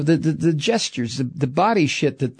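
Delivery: ordinary talk, nothing marked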